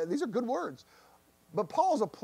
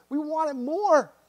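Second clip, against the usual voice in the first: eager longing; 7 semitones higher, 4 dB louder than usual. Pretend.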